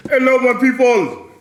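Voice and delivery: high, loud put-on African accent; African town crier. Town crier welcomes fairgoers, booming mock African accent. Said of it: "give the African town crier today".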